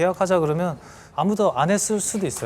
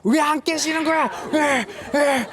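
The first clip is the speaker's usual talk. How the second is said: high-pitched, acted-out furious yell; exasperated shouting, pitched way up; angry outburst; 11 semitones higher than usual. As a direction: reenact losing your temper, firing off each "why". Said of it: "너무 화가 나서".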